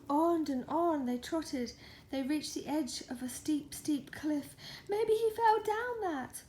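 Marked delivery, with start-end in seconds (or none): 4.9-6.4 high, louder wail. Acted. Cat wailing about the duck at the cliff. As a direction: cry it out in worry. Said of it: "wailed the cat".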